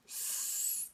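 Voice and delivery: soft, long hissing; playful imitated snake sound. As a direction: hiss like a snake. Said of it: "That's a snake".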